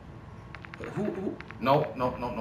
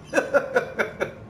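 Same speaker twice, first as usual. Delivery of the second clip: laugh; 9 semitones higher than usual. Real, not acted. Laughing at his own joke.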